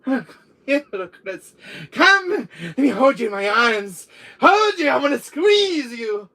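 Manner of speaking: high, loud, smothering affection; clingy villainess. Acted. Sister wanting to squeeze little Chris, hammed-up shrieking. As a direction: shriek your need for a cuddle.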